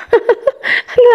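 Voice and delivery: short, joyless laugh; mirthless laugh. A narrator's reading. Hurt woman to her friend, mirthless laugh. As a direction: laugh with no fun in it. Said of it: "ضحكت بلا مرح".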